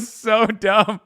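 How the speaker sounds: laughing it out loud; real laugh through the words. Real, not acted. Laughing at the old-time accent.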